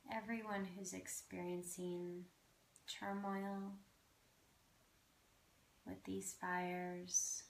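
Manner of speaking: guided meditation. Acted. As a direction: ease them gently into the breath.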